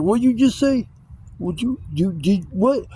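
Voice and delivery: high, incredulous mock challenge; indignant disbelief. Neighborhood kid reacting to the insult, indignant imitation. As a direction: imitate an outraged kid, voice jumping way up.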